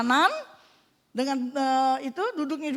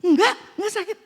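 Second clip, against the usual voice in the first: higher, louder exclamation; amazed reply; 5 semitones higher, 6 dB louder than usual. Retold or imitated. Answering the women who watched her walk, amazed exclamation. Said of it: "Ibu-ibu pada lihat begitu".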